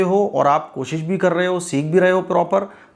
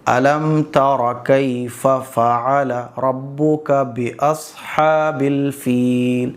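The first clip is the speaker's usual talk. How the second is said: a bit faster recitation; 4 semitones lower, much slower than usual. Shown as a demonstration. Teacher showing the next step after slow reading. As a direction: still slow but smoother. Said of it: "تھوڑا سا speed بڑھا دو".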